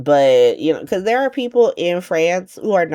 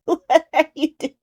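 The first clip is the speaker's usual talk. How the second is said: high voice breaking into laughter; real laughing; 11 semitones higher than usual. Real, not acted. Recapper laughing at Anna being crazy, laughing. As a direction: laugh helplessly through the question.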